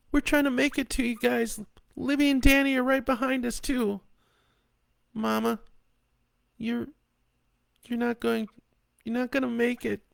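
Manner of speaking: high daughter voice, growing fearful; a grown daughter holding back tears; daughter on phone. A narrator's reading.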